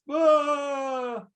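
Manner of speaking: high mock toddler wail "Ah!"; a short high bitten-kid cry. Interviewer acting the bitten toddler in the scenario, high cry. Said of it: "Jack cries".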